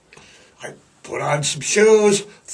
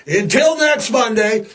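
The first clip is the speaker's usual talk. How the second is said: excited sign-off; 8 semitones higher than usual. Real, not acted.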